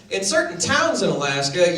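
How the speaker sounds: ordinary talk, nothing marked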